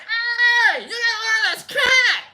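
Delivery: high-pitched excited yell; acted falsetto shout, urgent and excited. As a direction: shriek it to rouse your friends. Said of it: "I'm yelling, you know, in my head".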